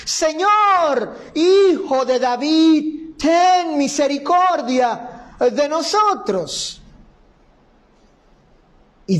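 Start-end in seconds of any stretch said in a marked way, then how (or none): 0.0-6.8 high, slow imploring call; crying out more. Acted. Blind men refuse to be silenced, crying out more. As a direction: cry out insistently, stretching the words. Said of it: "clamaban más diciendo".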